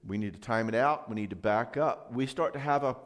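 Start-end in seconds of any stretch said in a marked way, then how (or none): none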